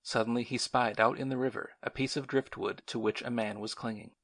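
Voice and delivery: usual speaking voice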